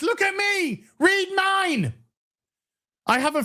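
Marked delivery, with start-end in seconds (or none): 0.0-1.9 high mocking whine, mimicking attention-seeking chatters; whiny mock plea for attention; mocking attention seeker.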